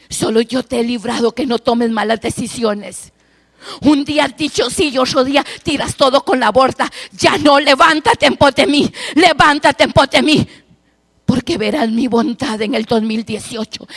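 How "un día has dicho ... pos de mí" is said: louder, urgent prophetic call; louder fervent prophecy; fervent preaching. Acted. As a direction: command her to rise up.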